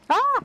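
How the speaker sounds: sharp high yelp of alarm; sudden scared cry; startled yelp. Real, not acted. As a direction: yelp as the boss hits. Hit by boss, yelps.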